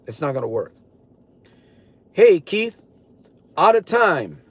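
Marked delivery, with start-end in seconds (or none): 2.1-4.4 raised call across the room. Real, not acted. Calling out to Keith about time, raised voice.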